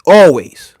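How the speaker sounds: "Always" punched loud and falling; emphatic always. Real, not acted. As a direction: hit "Always" hard on its own. Repeats word for emphasis.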